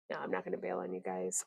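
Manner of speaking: hushed, breathy aside; soft whispered reassurance to the chat. Real, not acted. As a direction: whisper it like a secret promise. Whispered reassurance to stream viewers.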